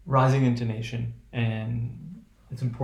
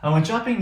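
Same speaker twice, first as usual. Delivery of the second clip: "shopping" pitched high as a question; high questioning tune on "shopping"; asking to validate; 7 semitones higher than usual. Shown as a demonstration. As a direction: raise "shopping" high, seeking a yes. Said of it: "the speaker is sort of asking".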